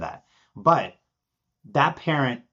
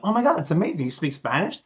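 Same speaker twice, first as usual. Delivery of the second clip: higher-pitched excited gush, retold; genuinely excited; 7 semitones higher, faster than usual. Speaker meeting a former language student, genuinely excited. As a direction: act out delighted amazement at someone. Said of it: "I genuinely get excited".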